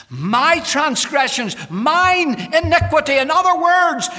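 high, loud, stretched pulpit cry; full-force preaching. Real, not acted. Preacher applies David's confession for congregation, full-force preaching.

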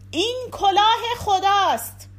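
high, loud proclamation; loud cry. A narrator's reading. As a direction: call it out aloud, pitched well up.

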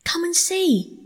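high, eager invitation; delighted. A narrator's reading. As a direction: call a friend over in delight. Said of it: "said the delighted rat".

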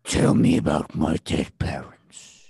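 slow, low, breathy demon whisper; a creeping voice in the dark. Acted.

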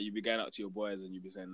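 ordinary delivery

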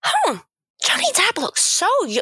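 high, swooning fan imitation; gushing young fan. Imitating Lily admiring Johnny Depp, gushing fan. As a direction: coo it like a smitten young fan.

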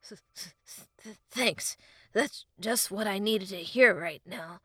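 shivering, stuttered retort; Link. A narrator's reading. Freezing boy answering the fairy, sarcastic shivering. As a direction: stutter the first word, then grumble through the cold. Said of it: "Link shivered uncontrollably".

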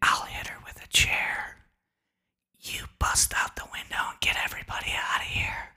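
hushed whispered plotting; secret whisper. Retold or imitated. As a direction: whisper the escape plan to your buddy. Secret plan whispered on floor.

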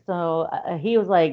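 ordinary talk

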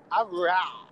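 "raw" standing for "real", all open A; emphasized A. Shown as a demonstration. Showing the emphasized A, "raw" said mockingly for "real". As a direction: lean on the A in "raw", dropping the L of "real". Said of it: "they emphasize the A".

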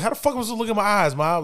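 high-pitched protesting son; indignant retort. Retold or imitated. High son talks back to mom, indignant. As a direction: act out a teen's outraged comeback.

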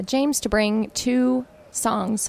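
ordinary talk, nothing marked

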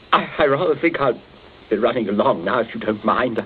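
low, halting polite refusal; shaken excuse. Acted. Shaken captive excuses himself.

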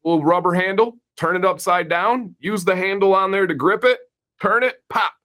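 high-pitched, quick Southern twang; Southern accent. Acted. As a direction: put on a Southern handyman giving step-by-step instructions. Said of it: "sorry for the accent".

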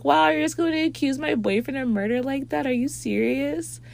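high, indignant disbelief; offended friend. Retold or imitated. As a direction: take offense, pitched well up. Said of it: "And she was like".

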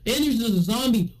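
ordinary delivery

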